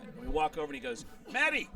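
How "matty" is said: high-pitched call to a friend. Retold or imitated. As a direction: call the name out on a high note. Calling to a friend across room.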